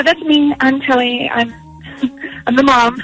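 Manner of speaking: normal talking voice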